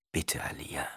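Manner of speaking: low, soft calming whisper; soothing whisper. A narrator's reading. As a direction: whisper to calm her. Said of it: "murmelte er begütigend".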